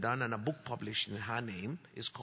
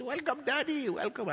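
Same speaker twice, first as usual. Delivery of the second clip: put-on high, quick excited child greeting; excited children; 12 semitones higher, 4 dB louder than usual. Children welcoming father home, excited. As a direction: imitate children happily greeting their father. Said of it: "were also very excited".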